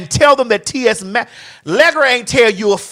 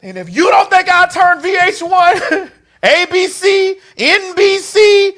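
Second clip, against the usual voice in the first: defiant boast; 8 semitones higher than usual. Real, not acted. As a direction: draw each network out high and defiant. Boasting threat, naming the networks.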